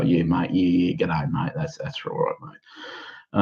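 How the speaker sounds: mock-Aussie drawl, low and laid-back; Aussie accent. Comedian sending up the boring Australian voice, exaggerated Aussie accent. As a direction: put on a dull, broad Australian voice. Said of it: "the Aussie voice is kind of like".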